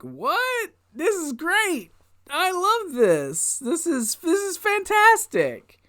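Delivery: very high, drawn-out squeal; giddy, high-pitched raving; gushing delight. Real, not acted. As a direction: gush in a slow falsetto. Gushing over the show in falsetto.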